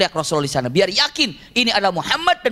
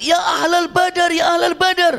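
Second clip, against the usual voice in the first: shouting call; 10 semitones higher than usual. Retold or imitated. (teriakan tinggi memanggil ahli Badar)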